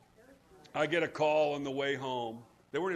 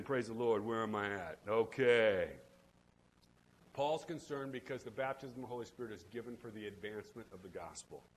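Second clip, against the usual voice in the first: ordinary talk, nothing marked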